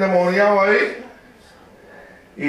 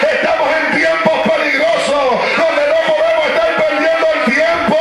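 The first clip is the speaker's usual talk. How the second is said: preaching at full force; 7 semitones higher than usual. Acted. Pastor exhorting the church, preaching at full force. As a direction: preach it hard to the congregation.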